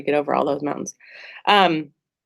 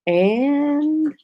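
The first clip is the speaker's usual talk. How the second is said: dramatic announcement; 9 semitones higher, much slower than usual. Pretend. Announcing the coach's arrival.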